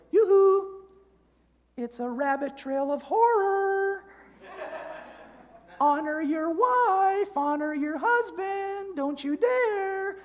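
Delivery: very high, drawn-out falsetto warning; angel on the shoulder. Acted. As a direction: scold in a slow, piping conscience voice. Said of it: "you hear the first voice which says".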